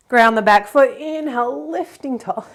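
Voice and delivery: breathless yoga cues, winded; short of breath while cueing. Real, not acted. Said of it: "I'm out of breath".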